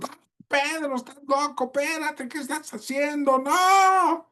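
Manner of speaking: high, frantic pleading cry; high fast frightened protest; panicked disciples. Acted. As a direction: shriek at a friend doing something mad. Disciples panicking as Peter steps out.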